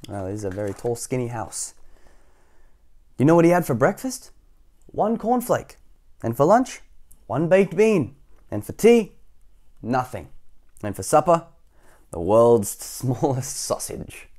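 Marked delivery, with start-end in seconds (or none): 3.2-14.3 playful higher questions, teasing answers; storybook narration. A narrator's reading. Reader listing Mister Skinny's meals, playful narration. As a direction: list it like a riddle, drawing out the last.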